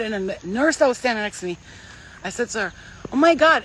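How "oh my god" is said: higher, louder burst of wonder; retold excitement. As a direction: blurt it out amazed. Telling the nurse excitedly.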